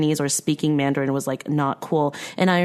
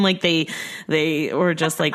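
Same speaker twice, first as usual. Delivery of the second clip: ordinary talk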